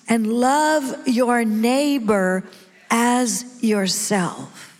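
stretched out, with pauses between phrases; very slow recitation. Shown as a demonstration. Preacher has the congregation repeat the commandment, very slowly. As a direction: draw out every key word, slowly and emphatically. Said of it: "Now let's say it again slowly".

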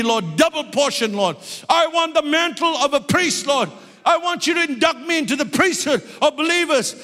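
high-pitched, pleading prayer in a preacher's cry; fervent prayer. Real, not acted. Preacher begging the Lord for the mantle of revival, fervent prayer. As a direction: cry out to God with all your heart. Prays aloud while preaching.

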